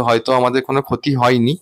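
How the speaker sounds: ordinary delivery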